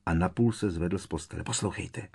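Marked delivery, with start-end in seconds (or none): 1.4-2.1 softer, hushed command; quick, secretive hush; hushed urging. A narrator's reading. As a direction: urge them to listen without being overheard. Urging others to hush and listen.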